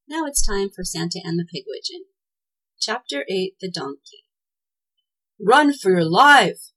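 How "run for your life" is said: loud, drawn-out urgent shout; shouted warning. A narrator's reading. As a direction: yell it as a warning, stretching the words. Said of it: "shouted Claus".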